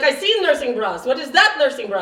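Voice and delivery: loud, high New York saleslady; New York accent. Acted. Bra fitter critiquing a nursing bra, New York accent. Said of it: "I can do a New York accent".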